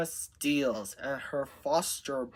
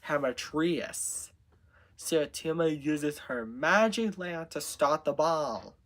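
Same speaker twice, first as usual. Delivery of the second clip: ordinary delivery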